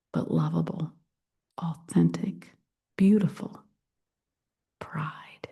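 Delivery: hushed, reverent closing words; whisper. Real, not acted. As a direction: fade into a breath. Closing sermon climax.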